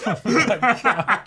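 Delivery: wheezing laugh through the words; can barely get it out for laughing. Real, not acted. Cohost cracking up at a joke, laughing through it.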